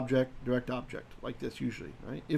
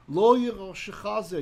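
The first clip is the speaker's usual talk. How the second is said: emphatic divine quote; 5 semitones higher, 6 dB louder than usual. Shown as a demonstration. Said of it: "Lemor, saying".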